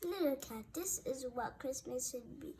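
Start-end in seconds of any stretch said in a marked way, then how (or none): none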